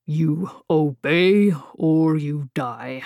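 slow, tired and heavy; a lightly weary giant. A narrator's reading. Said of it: "Baird said wearily".

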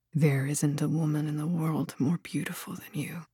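low, very soft murmur; tender lover. A narrator's reading. Killian tells the woman in his bed she is beautiful, tender.

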